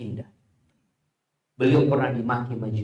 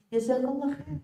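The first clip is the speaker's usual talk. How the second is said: much higher, sing-song thank-you; bright sweet thanks; 12 semitones higher, 5 dB quieter than usual. Retold or imitated. Preacher answers the mocker with high, sugary thanks. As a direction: kill the insult with sugary kindness. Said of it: "Kira-kira dia akan jawab apa".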